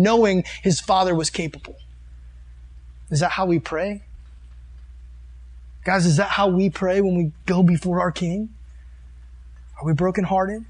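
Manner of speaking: normal talking voice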